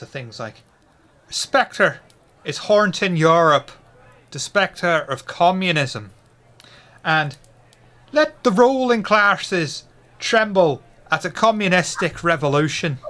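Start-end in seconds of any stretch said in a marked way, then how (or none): 1.3-13.0 put-on Irish accent. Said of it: "various different Irish accents".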